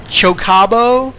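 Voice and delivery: "Chocabo?" loud, high, slow and questioning; loud unsure "Chocabo"; wrong pronunciation. Shown as a demonstration. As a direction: try "Chocabo" with a rising doubt. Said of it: "these are all wrong".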